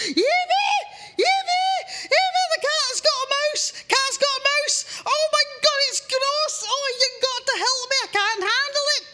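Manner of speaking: high, loud panicked shrieks for his wife; squealing in disgust and panic; husband shrieking for his wife about the mouse; panicked yelling. Acted. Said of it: "And Andy goes".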